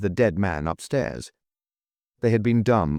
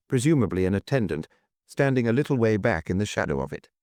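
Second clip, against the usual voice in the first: ordinary delivery, nothing marked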